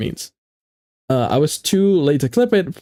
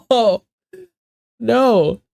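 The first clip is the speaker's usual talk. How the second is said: mock protest; 8 semitones higher than usual. Mock protest at being clipped.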